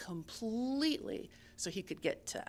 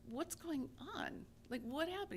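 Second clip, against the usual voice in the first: gentle; 9 semitones higher, 5 dB quieter than usual. Acted. Creator gently asks Rabbit.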